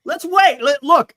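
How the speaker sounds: loud, high-pitched protest; exasperated plea. Real, not acted. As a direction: raise your voice to halt the panic. Pleads against premature panic.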